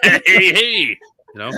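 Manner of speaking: loud, slow, leering nudge; creepy uncle. Acted. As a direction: elbow the listener, wink. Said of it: "kind of elbowing you in, in the arm a little too forcefully".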